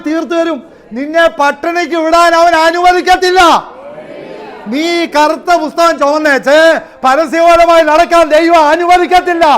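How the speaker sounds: loud, high fiery preaching; preaching at full force. Acted. Preacher shouting God's promise to the believer. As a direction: thunder it at the congregation.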